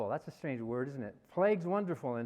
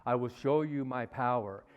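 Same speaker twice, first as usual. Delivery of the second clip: low, put-on almighty voice; megalomaniac God; 6 semitones lower than usual. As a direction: drop deep into a mock deity's threat. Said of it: "If you and I talk like that".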